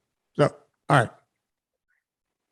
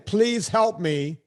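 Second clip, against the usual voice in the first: slow, higher, drawn-out please help me; pleading imitation; 5 semitones higher, much slower than usual. Man mimics himself begging the barista, slow and pleading. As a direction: mimic a desperate plea, dragging it out. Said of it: "when someone is saying".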